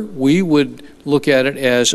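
ordinary talk

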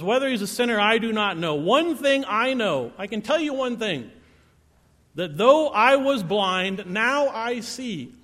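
emphatic irritated insistence, stretching the final phrases; ticked off. Acted. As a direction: insist on it, fed up with being asked. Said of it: "he's kinda getting ticked off".

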